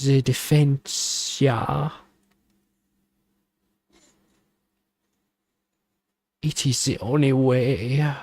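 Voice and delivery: a lightly hammed German advisor urging Kratos; German accent. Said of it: "Why are you speaking like this?".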